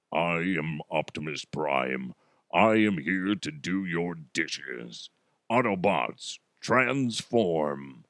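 deep, slow robot-hero voice; low, measured and solemn, an amateur imitation; Optimus Prime. Podcaster imitates the movie robot offering housework, deep Optimus Prime voice. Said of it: "I can't do it very well".

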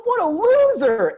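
very high, loud and slow scornful jeer; sneering taunt. Retold or imitated. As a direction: drag out the insult to the whole dugout. Speaker mocks the other kid.

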